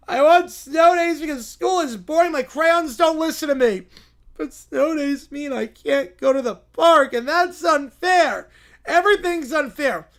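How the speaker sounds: lightly voiced child's grievance, stretched words; whiny complaining kid. Kid arguing that snow days are unfair, whiny. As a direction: protest like a sulky little kid.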